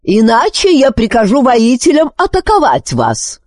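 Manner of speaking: high-pitched firm royal threat; commanding warning. A narrator's reading. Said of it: "произнесла она твёрдо".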